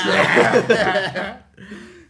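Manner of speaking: loud, bursting laugh. Real, not acted. Podcaster cracking up at his friend's crude joke, loud laugh.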